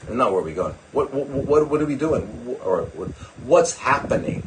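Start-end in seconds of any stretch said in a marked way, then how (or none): none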